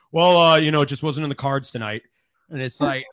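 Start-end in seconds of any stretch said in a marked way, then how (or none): 0.1-2.0 forced calm, held-back anger; restrained athlete. Retold or imitated. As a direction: hold it in politely. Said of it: "they have to".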